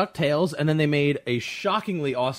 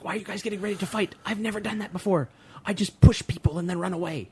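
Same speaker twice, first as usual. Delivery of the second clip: scared whispering; 5 semitones higher than usual. Retold or imitated. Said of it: "scared whispering".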